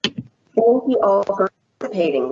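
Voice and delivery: ordinary talk, nothing marked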